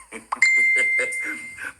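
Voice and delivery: a very high, loud cackling burst; sudden high-pitched howl of laughter; shrieking laugh. Real, not acted. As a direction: explode into a squealing laugh. Bursts out after insult.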